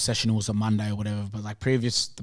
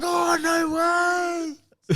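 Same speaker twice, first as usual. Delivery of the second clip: high, very slow, drawn-out no way; stunned delight; much slower than usual. Retold or imitated. Player told he'll play first grade, stunned and shouting with joy. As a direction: stretch it out high in happy shock. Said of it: "So I was just like".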